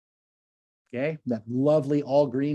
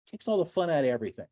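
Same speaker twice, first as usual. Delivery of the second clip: normal talking voice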